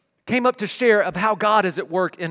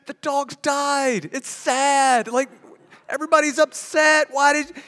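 wife; 9 semitones higher, 4 dB louder than usual. Retold or imitated. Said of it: "She's like".

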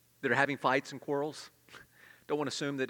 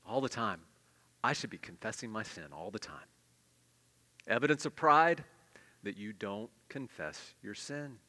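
normal talking voice

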